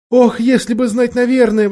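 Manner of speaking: exclaim, aching to be certain; distressed exclamation. A narrator's reading. Jill wishing she knew for sure, high exclamation. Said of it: "воскликнула Джилл".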